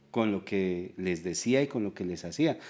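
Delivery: ordinary talk, nothing marked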